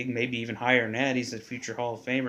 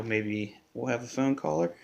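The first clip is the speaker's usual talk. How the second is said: usual speaking voice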